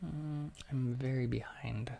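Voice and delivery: usual speaking voice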